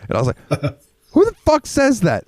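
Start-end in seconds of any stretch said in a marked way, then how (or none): 1.1-2.2 much higher, shocked outburst; stunned disbelief. Retold or imitated. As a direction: react as if you cannot believe your ears. Retold reaction to boss.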